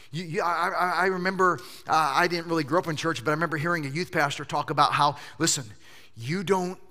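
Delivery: ordinary talk, nothing marked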